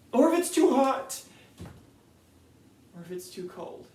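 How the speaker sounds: high, loud, manic protest; a loud, high overwrought denial; frantic ranting. Real, not acted. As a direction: spiral into loud comic panic. Frantic ranting self-contradiction.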